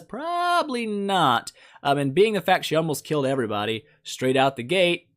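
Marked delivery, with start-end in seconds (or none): 0.0-1.5 high, stretched "probably" before "not"; drawn-out verdict. Real, not acted. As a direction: draw out "probably" teasingly, pitched up. Drawn-out mock deliberation over answer.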